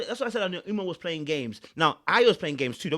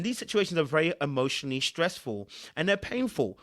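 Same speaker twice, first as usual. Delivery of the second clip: ordinary talk, nothing marked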